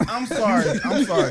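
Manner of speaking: laughing apology. Real, not acted. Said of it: "Well, cancel".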